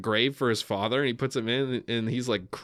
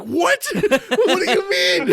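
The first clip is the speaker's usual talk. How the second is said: high, loud, slow stunned what, breaking into a laugh; shocked disbelief; 8 dB louder, slower than usual. Retold or imitated. Retelling his reaction to Krillin's death, loud high laughing disbelief. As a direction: recreate the jolt of the news, laughing. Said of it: "And I was like".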